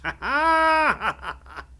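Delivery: high, loud, long cackle; villainous laugh. A narrator's reading. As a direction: cackle wildly over your victim. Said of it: "and laughed".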